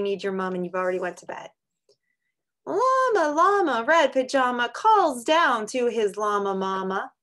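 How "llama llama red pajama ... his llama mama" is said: high, loud sing-song storybook call; reading to children. Acted. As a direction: read it out brightly to the kids. Reads a picture book aloud.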